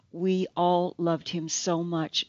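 ordinary delivery

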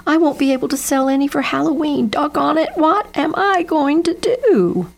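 high, rushing wail that slows at the end; a kid at his wits' end; wailing despair. Acted. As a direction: Jeb wails about losing his pumpkins. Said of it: "wailed Jeb".

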